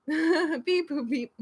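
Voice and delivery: high, loud beeps with a giggle; lightly voiced robot beeping; playful robot. Reading silly chat words to viewers, playful robot. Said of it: "Where my robot's at".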